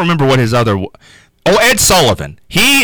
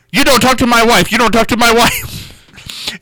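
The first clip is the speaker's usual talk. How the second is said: put-on higher-pitched comic accented warning; Hervé Villechaize; 7 semitones higher than usual. Jealous husband warning off a guest star, mock-accented. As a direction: imitate a riled little sidekick, pitched up. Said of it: "he talked very distinctive".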